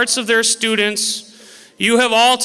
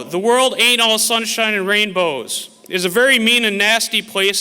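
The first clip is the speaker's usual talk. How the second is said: ordinary talk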